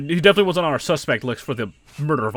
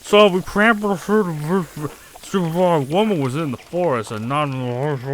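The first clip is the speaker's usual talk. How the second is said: mouth full; 4 semitones higher, 7 dB louder than usual. Acted. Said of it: "shoving bread in his mouth".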